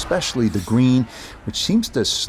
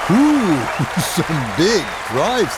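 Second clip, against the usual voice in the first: surprised ooh; 4 semitones higher, much slower than usual. Real, not acted. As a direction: gasp at the shot. Reacting to a big drive.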